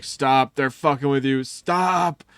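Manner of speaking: loud, high mock yell from teammates; yelling stop. Teammates yelling at him to stop falling for it, loud and high. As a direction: yell a warning at the gullible teammate.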